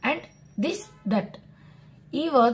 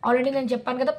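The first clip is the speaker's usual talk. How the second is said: ordinary talk, nothing marked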